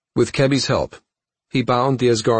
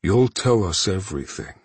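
menacing promise; 2 semitones higher than usual. Acted. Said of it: "Thanos promised".

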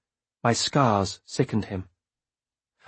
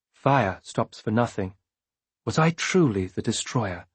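ordinary delivery

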